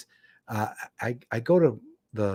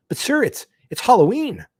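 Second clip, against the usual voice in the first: much higher, louder whiny deputy; pleading deputy; 11 semitones higher, 6 dB louder than usual. Retold or imitated. Deputy pleads with the sheriff, high and whiny. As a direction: squeak out the protest like a nervous deputy.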